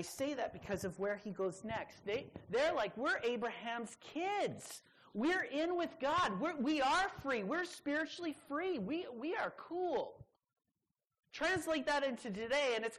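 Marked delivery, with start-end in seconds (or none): 3.0-10.2 high, smug mock-bragging voice; mock boastful crowd. Preacher mocking the self-satisfied crowd, smug and high. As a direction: lampoon the proud listeners, pitched up.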